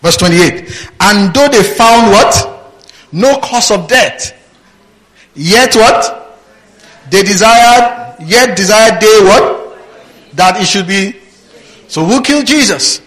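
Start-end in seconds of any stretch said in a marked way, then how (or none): 1.0-11.2 slow, raised preaching read; scripture reading. Acted. Preacher reading the verse to his congregation, higher and declaiming. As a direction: declaim the verse, pausing to quiz them.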